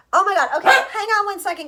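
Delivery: loud, high flustered burst; overwhelmed, scrambling to pause; loud, high frazzled exclamation. Real, not acted. Dog disrupting, flustered apology.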